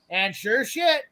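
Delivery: ordinary talk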